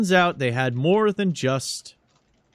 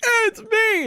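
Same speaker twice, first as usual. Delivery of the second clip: much higher goofy voice; costumed guy; 4 dB louder than usual. Acted. Costumed guy cheerfully answers the congressman, high. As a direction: wave and pipe up cheerfully. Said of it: "immediately goes like".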